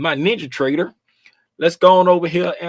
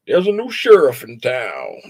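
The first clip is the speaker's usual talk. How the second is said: mock sheriff; 5 semitones lower than usual. Cowboy movie line.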